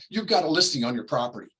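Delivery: usual speaking voice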